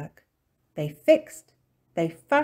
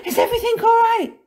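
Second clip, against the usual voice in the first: high, loud, rushed question; alarmed; 11 dB louder, faster than usual. A narrator's reading. Missus Plum running in, high and frantic. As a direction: ask in a fast, high panic.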